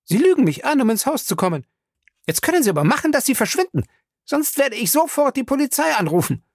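high, outraged scolding; furious high-pitched threat; snapping. A narrator's reading. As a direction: order him out indignantly. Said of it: "fuhr sie ihn an".

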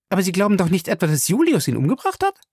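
high-pitched, abrupt worried question; suddenly alarmed, pitched high. A narrator's reading. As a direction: blurt out the question. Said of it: "fragte sie plötzlich".

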